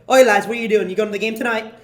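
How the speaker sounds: loud mock British greeting; thick UK accent. Woman calling to students walking back, imitated thick UK accent. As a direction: hail them in an imitated UK accent. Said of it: "very thick U K accent".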